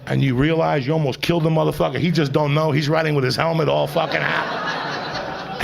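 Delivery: usual speaking voice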